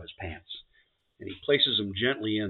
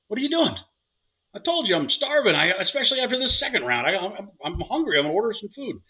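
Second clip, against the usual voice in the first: much higher, louder wife voice; new wife; 12 semitones higher, 6 dB louder than usual. Acted. Bride asking her husband why he is calling, high-pitched. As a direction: play the bride high and demanding. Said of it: "She goes".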